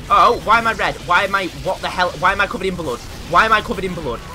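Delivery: loud alarmed questions; baffled outcry at turning red; loud confusion. Real, not acted.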